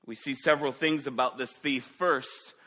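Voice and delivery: loud, driving sermon delivery; fervent preaching. Acted. Pastor to congregation, preaching loud and fervent. Preacher building through opening points.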